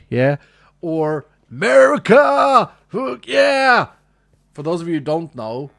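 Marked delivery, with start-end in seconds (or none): 0.8-3.9 loud, slow, higher hammed-up whoop; mock American cheer. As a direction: shout it like an over-the-top patriot.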